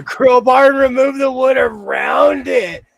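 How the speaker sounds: high, loud shout. Real, not acted. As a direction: belt out the plan at the top of your voice. Declares crowbar plan emphatically.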